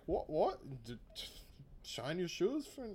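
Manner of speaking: half-hearted mock Cockney accent; bad British accent. Podcaster mocking the dub's Joseph as chimney sweep, bad British accent. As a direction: attempt a bad Cockney bootblack, then give up. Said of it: "try to do a bad British accent".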